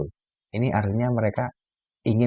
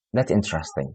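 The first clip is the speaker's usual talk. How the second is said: flat interesting; 3 semitones lower, 3 dB quieter than usual. Retold or imitated. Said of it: "Tanpa ekspresi wajahnya".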